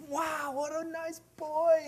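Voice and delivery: very high, slow, soft gush; admiring strangers, imitated. Grateful people praising the boy handing out water, high admiring coo. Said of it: "people just started going".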